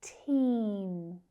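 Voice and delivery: Team with a drawn-out ee; teacher's model of Team, vowel held long. Shown as a demonstration. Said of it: "That long vowel sound".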